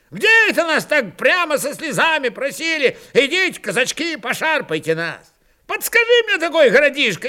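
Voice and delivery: put-on high, heated old Cossack's rant; explosive outburst. A narrator's reading. Wiry old Cossack scolds Fyodor for timidity, exploding. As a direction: blow up in indignant, high-pitched protest. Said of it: "Взорвался опять сухой жилистый старик".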